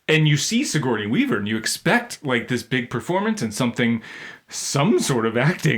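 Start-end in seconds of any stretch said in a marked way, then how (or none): none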